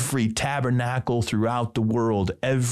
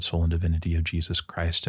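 normal talking voice

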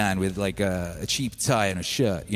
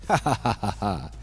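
imitated scornful laughter, ha-ha-ha; staged belly laugh at someone's plan; mocking boss laugh. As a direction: laugh at him like a dismissive boss. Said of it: "he laughed at me".

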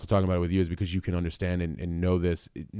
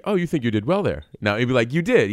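higher, louder teasing doubt; skeptical father; 5 semitones higher, 6 dB louder than usual. Retold or imitated. Father questioning his son's performance, louder and teasing. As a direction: needle him about his performance. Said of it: "He's like".

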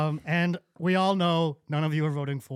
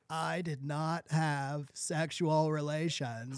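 slow, softer mock Clinton denial; mock Bill Clinton; 2 semitones lower, 7 dB quieter, much slower than usual. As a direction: drawl out the famous denial. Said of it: "Let me change my impersonation".

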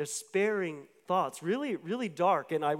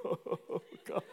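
breathy, voiceless imitation of sobbing; hammed-up ugly cry, drained of voice; mock weeping; 7 dB quieter than usual. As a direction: blubber it under the breath. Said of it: "ugly cry weep".

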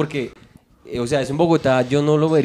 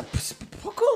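near-whispered, very soft imitation; hushed reply; 11 dB quieter than usual. Passenger answers about the traffic, whispered imitation. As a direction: barely voice the words. Acted murmured reply in story.

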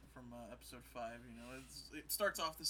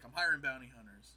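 mock announcement; 9 dB louder than usual. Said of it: "And he's like".

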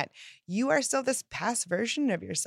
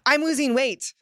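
high, loud, excited declaration; loud excited claim; 6 semitones higher, 7 dB louder than usual. Shown as a demonstration. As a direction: say it like you already won. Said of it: "which phrase is more exciting".

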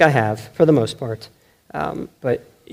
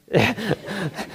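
short laugh; 6 semitones higher, 5 dB quieter than usual. Real, not acted. Laughing about family.

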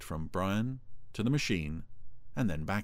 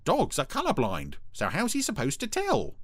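high voice climbing higher; chirpy, pitched-up needling; chipper Brian; 10 semitones higher, 3 dB louder than usual. A narrator's reading. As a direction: chime in with cheeky reasoning. Brian's character voice.

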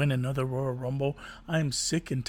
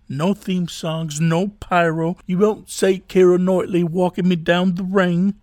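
rising to loud yelling; building shout; 4 semitones higher, 5 dB louder, slower than usual. Acted.

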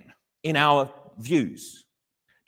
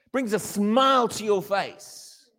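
ordinary talk, nothing marked

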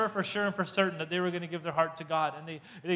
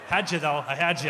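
laughing, teasing gotcha; laughing tease; 4 semitones lower than usual. Real, not acted. After fooling the audience, laughing teasing gotcha. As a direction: gloat through laughter. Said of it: "Just kidding".

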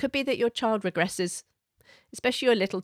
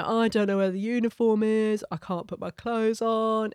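put-on regressing child voice; 3 semitones higher than usual. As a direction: play a child stalling, helpless. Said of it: "your child might easily regress and go".

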